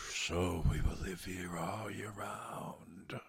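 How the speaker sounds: slow eerie whisper. Acted. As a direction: breathe it like cold frost. Snow and frost speak.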